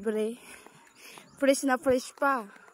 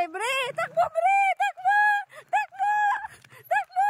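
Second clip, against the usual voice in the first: calling out. Real, not acted. Urging the dog to run.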